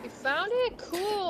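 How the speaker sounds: excited. Real, not acted. Teammate found the valve.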